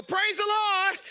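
loud high cry of Praise the Lord. Retold or imitated. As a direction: call it out so every head turns. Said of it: "and go".

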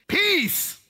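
high, ringing command of Peace; rebuking the sea. Retold or imitated. Jesus rebukes the sea, a high ringing call. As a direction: call out Peace to calm the storm. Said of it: "rebukes the wind and said to the sea".

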